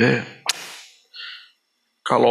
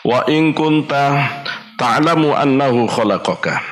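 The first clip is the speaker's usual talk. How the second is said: Arabic recitation; 4 semitones higher than usual. Acted. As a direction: intone the hadith deliberately. Recites Arabic hadith text.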